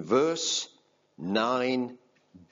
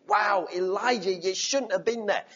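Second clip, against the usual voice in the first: higher, reproachful scold; mock shaming; 9 semitones higher than usual. Preacher imitating a shaming rebuke God never gave. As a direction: tut at him with disapproval.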